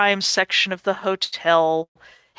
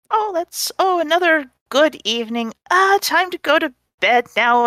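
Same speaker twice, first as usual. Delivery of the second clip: a lightly raised sleepy innkeeper; 8 semitones higher than usual. Acted. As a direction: play the tired innkeeper closing up. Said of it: "this is [name] saying".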